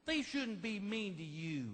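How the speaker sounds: slow, cooing sympathy; baby your husband with drawn-out sweetness; doting new bride. Retold or imitated. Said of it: "your new bride of one year would be like".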